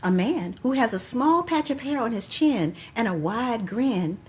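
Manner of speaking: rhythmic poem recital with cues; poetry call and response. Acted. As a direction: perform the poem, leaving room for the response.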